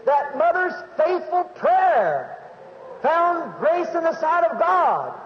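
high, drawn-out revival preacher delivery; impassioned testimony from the pulpit; fervent preaching. Acted. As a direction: proclaim it to the congregation, stretching the key words.

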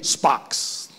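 usual speaking voice

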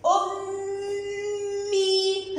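very slow "ummmmii" for أمي, holding the m; doubled consonant. Shown as a demonstration. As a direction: hum long on the م in أمي. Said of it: "حرف الميم شدة". (تشديد الميم في أمي مع إطالتها)

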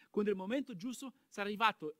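ordinary talk, nothing marked

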